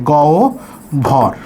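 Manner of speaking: গ ও glided into "gow", then ভর apart; correct pronunciation. Shown as a demonstration. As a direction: pause before breathy ভর. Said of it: "তখন উচ্চারণ হবে".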